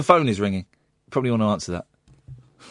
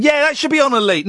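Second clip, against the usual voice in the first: higher-pitched indignant retort; retold anger; 11 semitones higher than usual. Host retelling his reply to the dog's owner, heated. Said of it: "I got angry with the owner".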